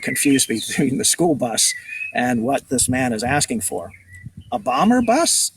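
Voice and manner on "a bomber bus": put-on much higher puzzled boy; high, incredulous question; baffled. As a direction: echo it in disbelief, pitch leaping up. Boy baffled by sailor's word.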